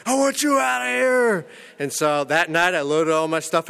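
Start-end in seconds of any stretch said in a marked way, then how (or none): 0.1-1.4 high, slow yell, imitating a furious landlord; landlord's yell. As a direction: bark out the eviction in a mock shout. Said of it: "and he's yelling".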